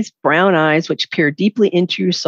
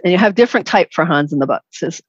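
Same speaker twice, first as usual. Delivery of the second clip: ordinary talk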